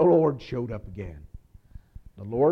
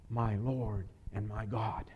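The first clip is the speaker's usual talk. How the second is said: slow, softer, hushed "My Lord and my God"; awed confession; 4 semitones lower, 7 dB quieter, slower than usual. Acted. Thomas confessing to Jesus, slow and hushed in awe. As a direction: breathe it out in reverent awe.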